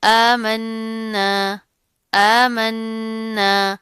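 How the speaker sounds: "आमन्ना" very slow, long hum on "न्न"; chanted "आमन्ना", first vowel and n sustained; ghunna. Shown as a demonstration. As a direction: hold the nasal n of "आमन्ना" firmly. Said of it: "गुन्ना करेंगे".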